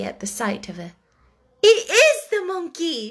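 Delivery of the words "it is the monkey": high, loud, delighted cry; cried out. Acted. Sarah crying out to Becky on seeing the monkey, loud and delighted. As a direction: call it out with slow joyful surprise. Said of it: "she cried out".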